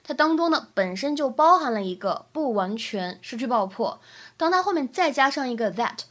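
ordinary talk, nothing marked

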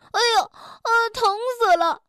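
高声哎呦喊疼; 摔疼了大叫. Acted. 摔下床喊疼. (摔疼了高声喊疼)

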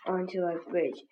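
usual speaking voice

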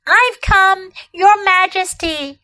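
put-on high, eager boy; rushing forward eagerly. A narrator's reading. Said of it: "rushing eagerly forward".

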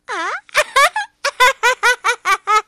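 high, loud laugh fit; breathless laughing. A narrator's reading. Frog laughing himself breathless at his fib, loud laugh fit. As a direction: laugh till you can't breathe. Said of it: "笑得喘不过气儿来了".

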